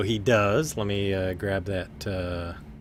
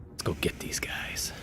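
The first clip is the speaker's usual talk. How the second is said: whisper; 9 dB quieter than usual. Acted. Freed creature plots quietly.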